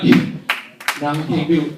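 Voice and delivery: low, slow mock whimper; low drawn-out whine of giving up; whining refusal. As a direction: sulk like a child who won't try. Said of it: "So you just go like".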